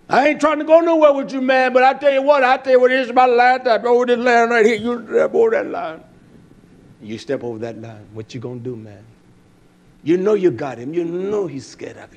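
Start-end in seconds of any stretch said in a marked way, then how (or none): 0.1-6.0 higher, louder bluffing voice; mock tough talk; bluffing bully. 7.0-9.0 low, soft menacing taunt; a hushed threatening aside. Retold or imitated. Narrating the taunt quietly.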